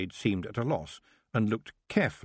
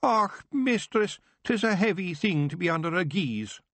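mock self-pity to win her round; hammed-up Scots lament; moaning; 9 semitones higher than usual. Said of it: "Rob moaned".

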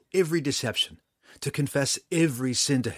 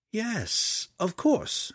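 much higher, lilting realization; sudden recognition; 11 semitones higher, much slower than usual. A narrator's reading. Drizzt looks himself over and pretends to remember, in sudden recognition. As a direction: let it dawn on you, pitched up. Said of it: "nodded in sudden recognition".

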